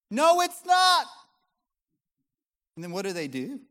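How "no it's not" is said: very high, loud whiny yell; exasperated yelling across the room. Retold or imitated. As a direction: complain loudly from the fridge. Husband yells back at fridge.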